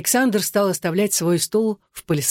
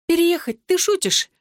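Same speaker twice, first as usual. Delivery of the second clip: incredulous; 11 semitones higher than usual. Acted. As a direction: pitch leaps up in disbelief. Vicky incredulous at the idea. (изумлённо, не веря)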